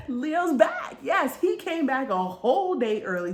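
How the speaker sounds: loud, high-pitched celebration; thrilled fan announcing a return; real excitement. Real, not acted. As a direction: burst out with the big news. Announces Leo's surprise return.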